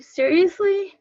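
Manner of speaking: high-pitched, upset disbelief; retold disbelief. Wife hearing of the Iraq deployment, retold disbelief. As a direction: let the question leap up high. Reacting to husband's deployment news.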